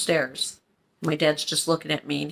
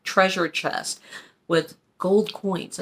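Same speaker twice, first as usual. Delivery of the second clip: ordinary delivery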